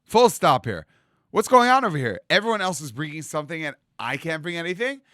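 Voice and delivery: loud, rising outrage; indignant protest. Retold or imitated. Moshe protests to Hashem.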